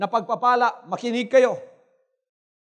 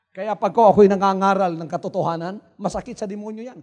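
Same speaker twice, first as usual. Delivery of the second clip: ordinary delivery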